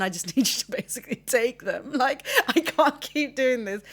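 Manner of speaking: real uneasy laughter cracking into crying; laughing through tears. Real, not acted. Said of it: "I'm laughing because it's such an uncomfortable conversation".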